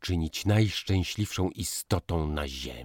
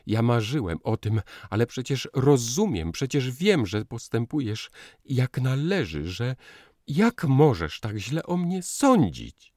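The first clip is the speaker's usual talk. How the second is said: anguished pleading; 7 semitones higher, 5 dB louder than usual. A narrator's reading. Pleading with his wife.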